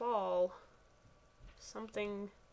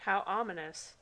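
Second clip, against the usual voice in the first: ordinary talk, nothing marked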